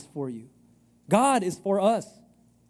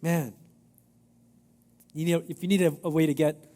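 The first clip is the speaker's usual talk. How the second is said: ordinary delivery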